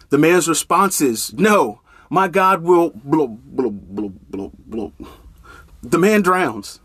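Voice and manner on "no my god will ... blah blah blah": dismissive sing-song brush-off fading away; mock the tired old refusal, trailing off; mocking repetition. Preacher mocking the drowning man's stock answer, dismissive trailing off.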